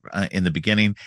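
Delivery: ordinary talk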